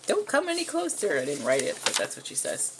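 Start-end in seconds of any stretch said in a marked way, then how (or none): 0.1-1.2 put-on high, loud frightened girl voice; shrieked warning from a panicked girl with pepper spray. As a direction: hold him off like a scared heroine. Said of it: "warns him".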